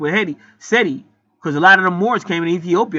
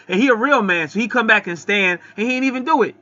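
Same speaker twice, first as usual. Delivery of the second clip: usual speaking voice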